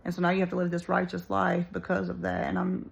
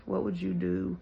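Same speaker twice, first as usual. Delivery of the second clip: normal talking voice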